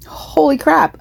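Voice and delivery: high surprised exclamation. Real, not acted. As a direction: blurt it out in disbelief. Reacting to death counts.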